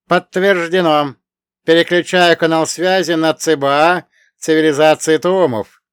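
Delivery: even, booming metallic machine tone; metallic robot voice. Acted. Us translator speaks again.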